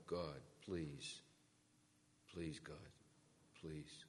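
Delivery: low, soft plea to God; acted prayer, softly fading away; hushed prayer. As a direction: pray quietly, voice dropping. Desperate prayer retold, very low level.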